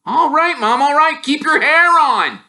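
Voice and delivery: high, loud, mocking surrender; teasing his mum to calm down; cheeky boy twin.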